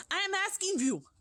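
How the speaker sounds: high, loud, foreign-edged witch voice; witch crying out. Acted. Grand High Witch rants to the witches about children, loud high witch voice. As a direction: rant with disgust at the children. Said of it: "she screamed".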